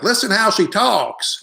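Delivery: high, drawn-out mock Southern accent. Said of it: "I had to do a Southern accent".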